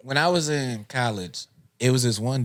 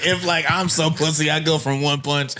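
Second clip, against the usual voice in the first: ordinary delivery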